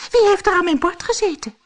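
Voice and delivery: high, loud and fast; quick, high-pitched storybook exclamation; indignant dwarf call. A narrator's reading. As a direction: cry out like an affronted little dwarf. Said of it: "riep de tweede".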